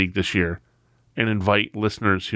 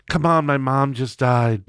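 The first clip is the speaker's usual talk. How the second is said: slow, drawn-out pleading imitation; imitated former boss; 3 semitones higher, slower than usual. Boss giving an excuse to his employee, slow droning plea. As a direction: plead in the boss's stretched-out drone. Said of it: "he used to talk like this".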